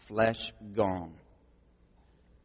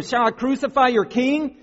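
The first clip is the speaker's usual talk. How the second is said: high, forceful incredulous question; raised-voice challenge; loud challenge; 13 semitones higher, 9 dB louder than usual. Acted. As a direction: throw the question at a crowd. Pilate calls back to shouting crowd.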